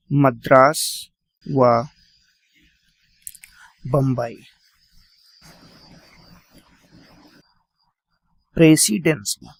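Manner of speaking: long pause between words; slow dictation. Shown as a demonstration. Teacher dictating presidency names, slow and spaced. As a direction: give each word time on the page. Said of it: "note कर लीजिए".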